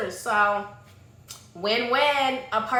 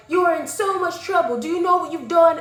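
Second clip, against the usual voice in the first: higher, louder mock telling-off; alarmed, pitched-up reprimand; acted scolding; 5 semitones higher, 5 dB louder than usual. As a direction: scold someone in big trouble. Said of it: "basically they're like".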